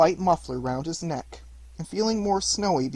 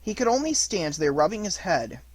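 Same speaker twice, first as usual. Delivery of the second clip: ordinary delivery, nothing marked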